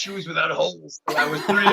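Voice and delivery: mock masked villain, cracking up; laughing through a villain impression; muffled villain voice breaking into laughter; Bane.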